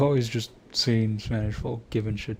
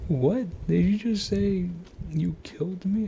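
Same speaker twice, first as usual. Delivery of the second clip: imitating shocked friend; 9 semitones higher than usual. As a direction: play the friend who can't believe it. Friend reacting to Spanish.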